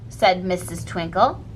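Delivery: plain reading voice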